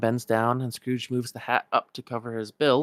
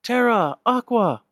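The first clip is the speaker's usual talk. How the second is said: much higher, loud call of two names; 12 semitones higher, 7 dB louder, slower than usual. Acted. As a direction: shout to friends across the square. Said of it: "Ven calls out".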